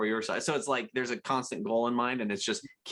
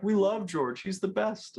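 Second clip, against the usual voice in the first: mimicking fans; 7 semitones higher, slower than usual. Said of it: "they're like".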